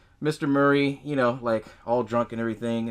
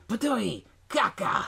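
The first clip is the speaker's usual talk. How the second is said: a hint of a gremlin spitting, a touch higher; 8 semitones higher, slower than usual. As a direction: spit it out like a disgusted little monster. Gremlin spits out the apple.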